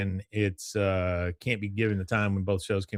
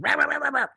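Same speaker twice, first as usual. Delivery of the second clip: rapid high-pitched mock dog barks; imitated pit bull barking; much faster than usual. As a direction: bark like a dog charging on its chain. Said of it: "like, a pit bull that runs up".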